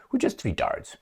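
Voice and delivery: high mocking sarcasm; sarcastic. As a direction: play dumb with a sneer. Sarcastic self-mockery.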